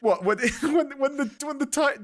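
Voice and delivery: ordinary talk, nothing marked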